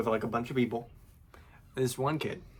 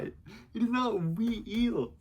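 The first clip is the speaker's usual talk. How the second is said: exasperated; 10 semitones higher, slower than usual. Real, not acted. Reliving the argument, exasperated.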